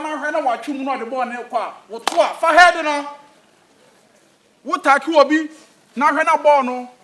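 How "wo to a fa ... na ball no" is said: emphatic coaching shout. Acted. Coach drilling players on headers.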